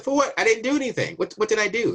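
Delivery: high, loud outraged "for what"; indignant protest. Retold or imitated. To the border guard, innocent man protesting in high indignant disbelief.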